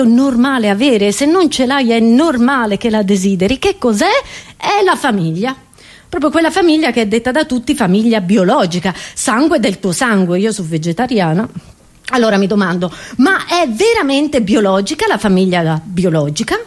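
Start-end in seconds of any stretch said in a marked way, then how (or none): none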